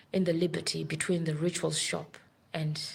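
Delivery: usual speaking voice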